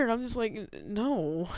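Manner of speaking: ordinary delivery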